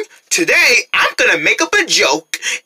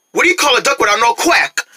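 normal talking voice

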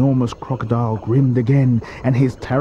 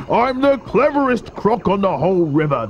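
higher, indignant croc retort; puffed-up crocodile bragging; boastful crocodile; 8 semitones higher than usual. A narrator's reading. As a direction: boast back with raised pitch.

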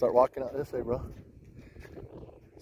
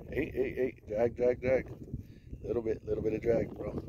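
ordinary delivery

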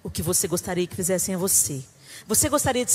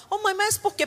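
put-on higher child's question; whining child; 10 semitones higher than usual. Son questioning his mother, higher whiny child. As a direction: ask like a whining kid. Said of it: "o filho fala".

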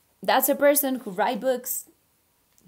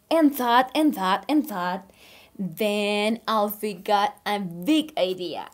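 put-on reading to children; 3 dB louder than usual. Teacher reading a story to her online class, animated. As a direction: read aloud like a picture book for little kids. Reads picture book aloud.

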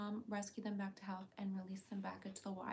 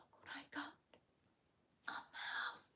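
whispered, no voice, teasing; hushed toy reveal; excited whisper; 5 semitones higher, 9 dB quieter than usual. Real, not acted. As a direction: tempt the pet with a toy in a whisper.

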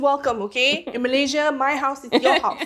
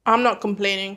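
talking with mouth full; words around a bite of bread; 3 semitones lower than usual. Real, not acted. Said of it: "I've caught you with your mouth full".

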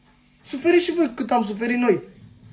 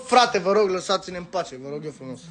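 usual speaking voice

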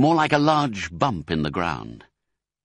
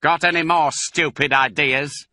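higher-pitched, moderate shout; raised-voice repeat for a deaf listener; shouting back; 7 semitones higher, 4 dB louder than usual. A narrator's reading. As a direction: shout it back to someone hard of hearing. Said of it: "Bunce shouted back".

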